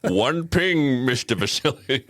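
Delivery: mock Scottish-Russian accent, lifted in pitch; hammed-up Connery doing a Russian captain; Sean Connery. Co-host joining in with Hunt for Red October, mock Connery accent. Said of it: "Sean Connery trying to do a, a Russian accent".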